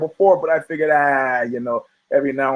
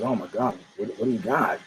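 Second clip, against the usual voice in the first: lower, softer alarmed bartender; mock worried bartender; 6 semitones lower, 9 dB quieter than usual. Barman asks the horse, lower and softer. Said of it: "the bartender goes".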